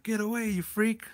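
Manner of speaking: mock rebuff. Acted quote rebuffing someone.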